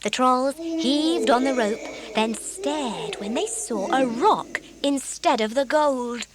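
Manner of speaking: plain reading voice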